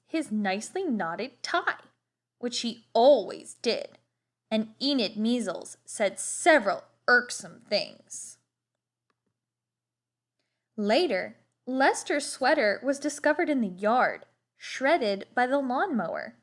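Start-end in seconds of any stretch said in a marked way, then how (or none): none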